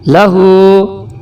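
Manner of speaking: لہو with the ہو held very long; stretched ہو. Shown as a demonstration. Teacher reading the Roman Urdu slowly. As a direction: hold the ہو in لہو. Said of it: "یہ green این جو ہے یہ نہیں پڑھنا".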